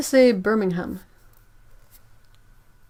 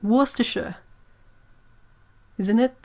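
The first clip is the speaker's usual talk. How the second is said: ordinary delivery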